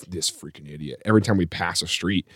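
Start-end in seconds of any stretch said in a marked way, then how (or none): 0.1-1.0 low, soft muttered curse; mumbling. Retold or imitated. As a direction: grumble it under your breath. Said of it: "he's mumbling stuff".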